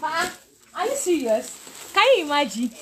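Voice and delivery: high, laughing disbelief; real astonished laugh at a gift; delighted surprise. Real, not acted. Teacher reacting live to a surprise gift, high delighted disbelief.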